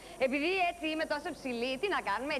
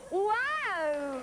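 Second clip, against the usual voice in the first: showy wow cry; 5 semitones higher than usual. Acted. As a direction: belt out a cheesy game-show wow. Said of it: "μπορώ να το κάνω, κοιτάξτε". (ενθουσιώδες «ουάου» παρουσιάστριας)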